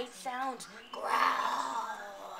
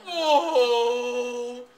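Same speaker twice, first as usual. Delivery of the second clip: bear moaning; 10 dB louder than usual. Acted. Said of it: "he moans".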